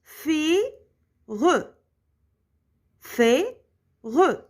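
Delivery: "fait, re" slowly separated, then repeated; syllable by syllable. Shown as a demonstration. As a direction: pause between "fait" and "re" each time. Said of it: "La deuxième".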